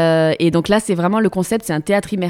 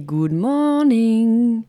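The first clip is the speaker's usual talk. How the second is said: audience wake-up call; 4 semitones higher, much slower than usual. Retold or imitated. Audience calling out the cue.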